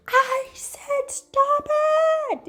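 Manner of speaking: high, slow yelled outburst; sudden shout. Retold or imitated. Angry partner suddenly shouting at the other. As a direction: burst out at your partner. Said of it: "you know, so loudly".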